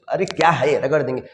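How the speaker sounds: usual speaking voice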